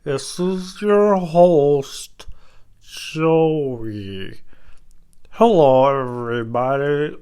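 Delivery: drawn-out mock radio host intro; slow, stretched podcast host voice; hammed-up show opener; announcer intro. Shown as a demonstration. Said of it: "That's enough of that".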